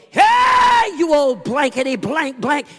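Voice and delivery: high, loud jeering shout; yelled taunt. Retold or imitated. Boys jeering at the old man, a loud high shout. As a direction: hurl the insult out the car window.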